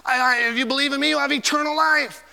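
high, loud proclamation; Jesus preached. Acted. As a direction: call out the promise of life. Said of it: "Jesus said".

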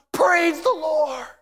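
high, drawn-out exultant shout; shouted praise. Acted. Preacher quoting the Bible's praise, exultant shout. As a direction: hurl the praise out high and slow.

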